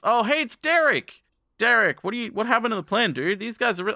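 high, excited acted greeting; surprised recognition. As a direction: spot a friend, then blurt out confusion. Acted spotting Derek, then confusion.

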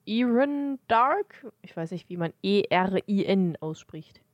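ordinary delivery

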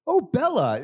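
high, sing-song mother calling out; mock mom calling Bella over. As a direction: coo her name like a proud mom. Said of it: "you do this funny, like, mom thing".

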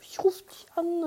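lightly voiced clerk promising a call; imitating clerk. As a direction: mimic the mechanic offhandedly. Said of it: "Und er war so".

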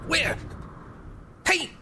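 high-pitched frantic cries, fighting off an attacker; breathless high panic, gasping at the end; panicked screaming. Acted. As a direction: scream in terror as it claws at you. Bat attacks his face and throat.

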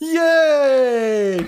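high, loud, long whoop; mock cheer. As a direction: cheer like a delighted crowd. Cheering more tops pulled down.

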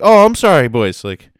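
high, loud, overly apologetic Canadian; polite Canadian guy. Retold or imitated. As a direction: gush apologies like an eager worker. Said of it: "And he was like".

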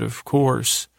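usual speaking voice

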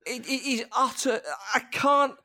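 high, worked-up outburst of dismay; exasperated plea. Real, not acted. Podcast guest warns listeners off the film, exasperated and pleading. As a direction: beg them, at the end of your tether.

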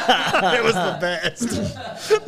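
real laughter, high and drawn out through "It was the best"; can barely get the words out for laughing. Real, not acted. Host cracking up at his own tent story, real laughter.

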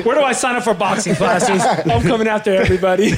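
playfully acting ready to fight everyone; mock fired-up. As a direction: hype yourself up for a fight. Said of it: "Joe playing himself angry".

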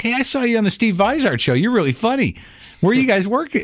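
high chirpy fan waiter; cheery waiter. Retold or imitated. Waiter greeting comic, high chirpy. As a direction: greet him brightly as a fan. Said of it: "the waiter comes up and is like".

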